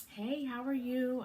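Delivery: high, slow, sing-song hello; a bright, drawn-out mock nurse hello; cheery greeting. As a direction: play the bubbly medical assistant. Nurse greets her, retold.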